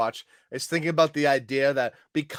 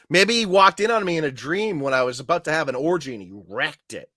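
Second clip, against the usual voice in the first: normal talking voice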